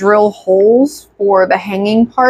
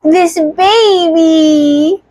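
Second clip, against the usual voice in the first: very slow, high and drawn out; 7 semitones higher, much slower than usual. Real, not acted. Presenting the baby.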